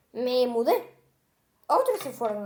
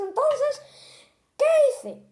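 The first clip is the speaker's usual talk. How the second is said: entonces climbing high and loud into the question; suspense peak; 12 semitones higher, 5 dB louder, slower than usual. Acted. Monster builds suspense for the children, high and loud. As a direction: build the tension and burst out.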